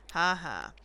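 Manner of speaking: ordinary talk, nothing marked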